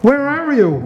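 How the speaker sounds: a half-hearted shout into the dark; mild mock shout. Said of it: "he shouted".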